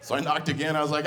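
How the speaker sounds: ordinary talk, nothing marked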